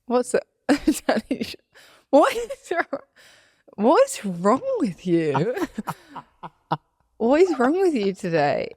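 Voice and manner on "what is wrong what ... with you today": high, laughing what is wrong with you; laughing disbelief. Real, not acted. Teasing her laughing cohost, high laughing disbelief. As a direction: tease her through laughter.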